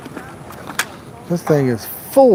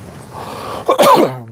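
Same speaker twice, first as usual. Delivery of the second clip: coughing; 11 semitones higher than usual. Real, not acted. Coughing after swallowing bug.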